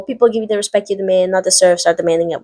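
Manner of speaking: fast, offhand recap of his speech; rattled-off quote. Retold or imitated. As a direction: rush through it as a familiar quote. Rattles off a quote already told.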